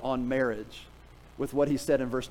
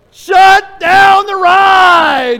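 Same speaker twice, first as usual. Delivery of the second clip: drawn-out full-throated yell, much higher and louder; 20 dB louder, much slower than usual. Retold or imitated. Rider yelling at the operator to stop, full-throated yell. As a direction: scream it to stop the spinning ride. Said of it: "I yelled".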